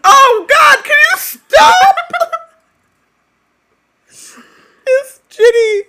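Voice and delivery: real overwhelmed crying-laugh, slowed; crying shout. Real, not acted. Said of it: "And I'm still crying".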